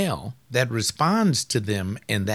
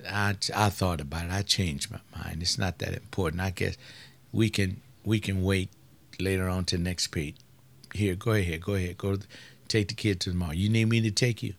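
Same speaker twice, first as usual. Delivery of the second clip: softer, lower, gentle concession; tender giving in; 5 semitones lower, 6 dB quieter than usual. Retold or imitated. Husband tenderly conceding to wife, soft and low. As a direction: give in quietly and kindly.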